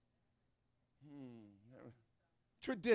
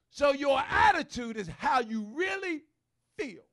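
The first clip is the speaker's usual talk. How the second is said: preaching; 6 semitones higher, 12 dB louder than usual. Real, not acted. Preacher climbs to a shout.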